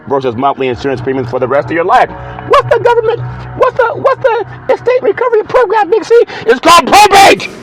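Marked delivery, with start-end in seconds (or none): 2.5-6.1 very high squeaky questioner voice; hammed-up puzzled caller, pitched way up; mock questioner. Voices a questioner asking Big C. 6.5-7.5 loud, high comic alarm; mock-alarmed shouted reply; hammed-up warning. Mock alarmed answer about probate.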